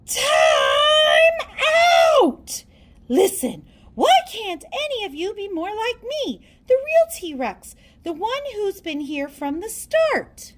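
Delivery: shouted Time out! then a scolding rant; a storybook dinosaur losing her temper, shouting; tantrum. Acted. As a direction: yell to stop the chaos, then scold. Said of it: "one totally terrifying tantrum".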